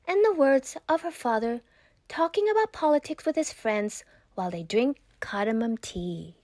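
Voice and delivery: plain reading voice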